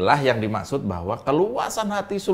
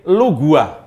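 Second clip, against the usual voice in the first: "lu gua" in outskirts Betawi, ending on "a"; louder outskirts Betawi "lu gua" at usual pitch; outskirts Betawi accent; 6 dB louder than usual. Shown as a demonstration. Showing a co-host the fringe Betawi dialect, "gua" ending in an a sound. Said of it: "Betawi agak pinggir".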